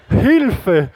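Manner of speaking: high, loud mock cry for help; hammed-up panicked cry; mock alarm. Preacher recoils from odd stranger, mock alarm.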